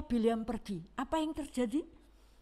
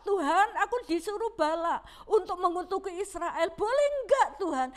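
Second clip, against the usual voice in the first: pleading Bileam; 7 semitones higher than usual. Retold or imitated. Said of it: "dia minta".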